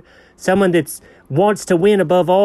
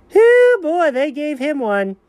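excited hoot; 10 semitones higher, slower than usual. Real, not acted. A high hoot while joking about the movie with the dog. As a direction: hoot with glee, then settle.

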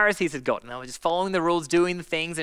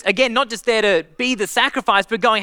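ordinary delivery

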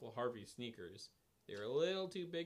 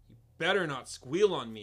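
loud, forceful order; commanding; 2 semitones higher, 10 dB louder than usual. A narrator's reading. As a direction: bark the threat down at her. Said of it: "you command".

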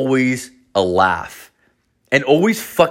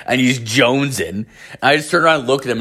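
ordinary delivery

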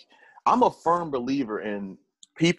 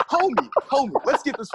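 high excited call-out; 12 semitones higher, 4 dB louder than usual. Real, not acted. Podcast host mocks the fighter, high and laughing. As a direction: pitch shoots up, laughing disbelief.

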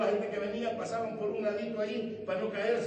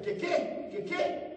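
indignant mother; 12 semitones higher, much slower than usual. Retold or imitated. Mother challenging her son who sucked his teeth, indignant. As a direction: imitate an offended mother demanding a repeat.

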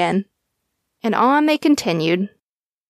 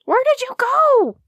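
puzzled little dog; 3 dB louder than usual. A narrator's reading. Dog searching for squirrel.

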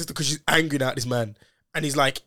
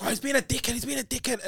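agitated retold complaint; 8 semitones higher, 6 dB quieter than usual. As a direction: imitate a sister complaining, pitched up.

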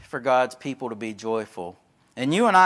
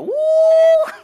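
much higher, louder whoop with a laugh; excited whoop; 12 dB louder than usual. Retold or imitated. Glenn reacting to fish photos with a loud, high delighted whoop. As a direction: imitate Glenn's delighted holler. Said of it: "he'd go".

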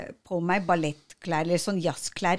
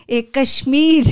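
higher, louder answer through a laugh; 7 semitones higher, 8 dB louder than usual. Retold or imitated. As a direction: blurt it out, giggling nervously. (leende, nølende svar)

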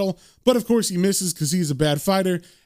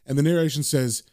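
plain reading voice

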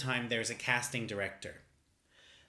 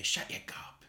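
a hushed, lower whisper of a scolding parent; 6 semitones lower than usual. Retold or imitated. Parents telling a kid off, whispered scold. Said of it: "your parents would say".

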